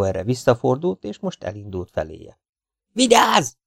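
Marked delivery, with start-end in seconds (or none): 2.9-3.5 very high-pitched alarmed yell; yelled warning. A narrator's reading. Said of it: "üvöltötte a partizán".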